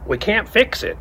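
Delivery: high, loud mocking echo of the congressman; jeering imitation of the politician; mocking quote. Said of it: "he says".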